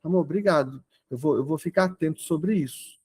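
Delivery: soft, humble thanks; resposta compreensiva. Retold or imitated. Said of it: "Eu não falo assim". (agradecimento calmo, em voz baixa)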